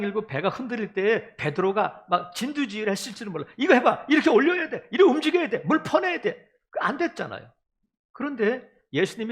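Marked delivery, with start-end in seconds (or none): 3.6-6.5 higher and louder, barking commands on the boat; a fisherman taking charge in the storm, shouting; bossy shouted orders. Retold or imitated. 베드로가 배에서 지휘. (배 위에서 높고 크게 외치는 베드로의 지시)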